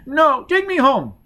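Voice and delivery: put-on very high, loud, begging little-girl voice; pleading frightened child. A narrator's reading. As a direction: wail for Nanny like a terrified small child. Child begs to go home.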